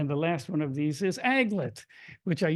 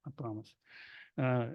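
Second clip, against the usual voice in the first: normal talking voice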